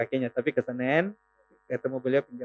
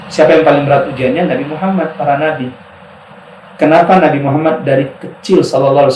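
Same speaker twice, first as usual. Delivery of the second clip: loud, earnest preaching to students; preaching advice; 6 dB louder than usual. Acted. Preacher advising students in pesantren, loud and earnest. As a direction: urge the young ones to keep faith.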